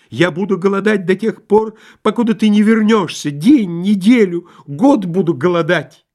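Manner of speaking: high, loud tragic vow; hammed-up shouted threat; unhappy husband's cry. A narrator's reading. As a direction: proclaim it with rising desperation. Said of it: "закричал несчастный муж".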